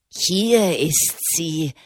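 slow, drawn-out call in a put-on voice; acted cry. A narrator's reading. Greasy little man alerting the mob, drawn-out cry. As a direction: call out the find, stretching the words. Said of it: "schrie er".

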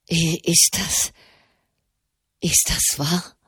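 mild quaver of disbelief; trembling voice. Acted. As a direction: ask with a trembling voice. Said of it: "fragte er mit bebender Stimme".

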